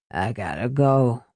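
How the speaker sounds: understated weary sigh. A narrator's reading. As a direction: sigh it in a low voice. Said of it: "he sighed".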